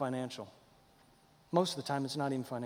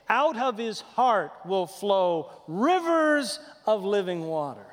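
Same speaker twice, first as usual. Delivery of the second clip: slow, raised proclamation with long pauses; preached scripture; 5 semitones higher, 5 dB louder, slower than usual. Acted. Preacher quoting Jesus to the congregation, slow proclamation. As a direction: proclaim it to the whole room, word by weighty word.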